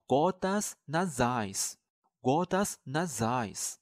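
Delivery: gotas nasais said slowly and clearly, twice; slow model pronunciation. Shown as a demonstration. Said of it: "滴鼻水该怎么说呢". (慢速示范读gotas nasais)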